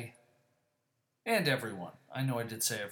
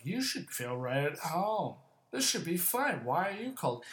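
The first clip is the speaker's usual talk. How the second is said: higher-pitched mimicry of know-it-alls; mocking know-it-alls; 5 semitones higher than usual. People up north questioning his feeling cold, mocking. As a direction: imitate them with exaggerated, swooping intonation. Mocks what people tell him.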